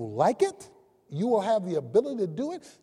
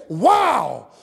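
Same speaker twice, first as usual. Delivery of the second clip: amazed exclamation; 2 semitones higher, 7 dB louder, much slower than usual. Retold or imitated. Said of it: "And you say".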